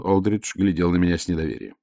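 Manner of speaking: ordinary delivery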